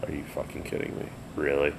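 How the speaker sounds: hushed, fast disbelief; disbelief under breath. Retold or imitated. Muttering to himself at the drunk singer, hushed disbelief. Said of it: "I'm like".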